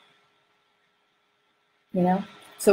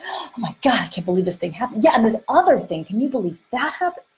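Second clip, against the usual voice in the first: mock-amped disbelief, pitched up; gasping, piling on the outrage at a higher pitch; worked-up, kept high; 4 semitones higher than usual. Speaker acts out holding emotions high, worked-up. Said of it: "keeping them high".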